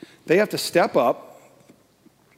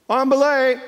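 high, loud, carrying call; 9 semitones higher, 5 dB louder than usual. Acted. Believer calls to God like a climber, calling out.

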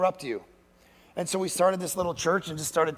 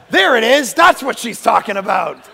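a louder, excited there it is; mock revelation, louder; loud realization; 2 semitones higher, 8 dB louder than usual. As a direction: exclaim the discovery. Realising after accident.

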